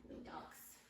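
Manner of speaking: whispered, very soft praise. Real, not acted. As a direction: breathe the praise softly. Soft praise to settled dogs.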